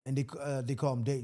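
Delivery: ordinary delivery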